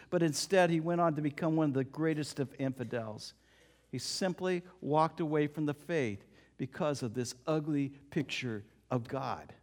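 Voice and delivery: ordinary talk, nothing marked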